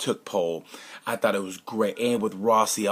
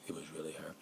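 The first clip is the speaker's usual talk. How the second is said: very hushed mock confession; secretive aside; 5 semitones lower, 19 dB quieter than usual. Man confides after leaving a relationship, hushed aside. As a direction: drop to a near-whisper behind someone's back.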